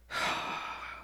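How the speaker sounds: long, heavy exhale; deep sigh. Retold or imitated. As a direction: let out a deep, resigned sigh. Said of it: "took a deep sigh".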